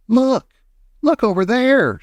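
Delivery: high mock scream of excitement; excited scream. A narrator's reading. Girl alerting everyone across the river, high excited cry. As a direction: cry out high, without full force. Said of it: "she screamed".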